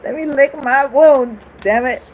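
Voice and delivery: hammed-up grouchy wish to be left alone; grumpy hermit crab. Describing her birthday mood to the viewer, loud mock grouch. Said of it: "I'm like".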